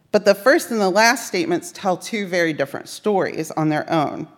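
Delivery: normal talking voice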